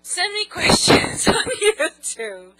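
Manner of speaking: loud, slow, laughing through it; real laughter breaking the sign-off; laughing retake. Real, not acted. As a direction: crack up while redoing the line. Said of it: "That was so stupid".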